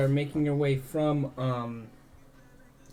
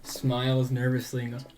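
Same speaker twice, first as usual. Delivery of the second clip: ordinary talk, nothing marked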